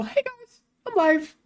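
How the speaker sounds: high, lilting "going live" greeting; announcing live stream. Acted. Streamer announcing the stream to followers, high and chirpy. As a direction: pitch it up like a post caption. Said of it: "post a little image and go".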